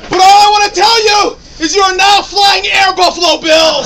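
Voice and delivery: loud rallying crowd hype; halftime pep speech. Real, not acted. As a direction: fire up the bar crowd. Rallying crowd at Bills bar.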